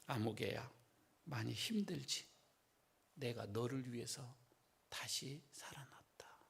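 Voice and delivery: soft, hushed and tender, fading to a whisper; gentle hush. Retold or imitated. The Lord calling a grieving believer, softly and tenderly. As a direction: comfort a weary soul softly. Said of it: "큰 소리 하지 않습니다".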